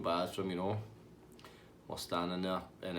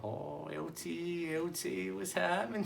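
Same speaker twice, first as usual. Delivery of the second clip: high, softer, drawn-out greeting; Tasty Dave; 8 semitones higher, 5 dB quieter, slower than usual. Retold or imitated. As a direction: croon the nickname slowly up high. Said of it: "He's like".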